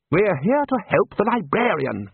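high, slow emphatic rebuke; firm, irritated reminder; snapped. A narrator's reading. Ridcully rebukes the chair, snapping. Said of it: "snapped Ridcully".

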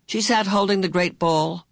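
usual speaking voice

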